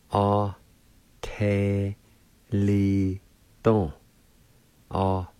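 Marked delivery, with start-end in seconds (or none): 0.1-3.9 "A, te, li, don" split into separate spaced syllables. Shown as a demonstration. Teacher sounding out the word plate, syllable by syllable. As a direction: spell out "A, te, li, don" by sound, pausing between each. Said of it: "is pronounced like this".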